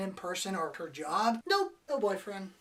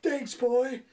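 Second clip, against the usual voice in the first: grateful to rooster; 6 semitones higher, 8 dB louder than usual. Retold or imitated. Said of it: "He'd be like".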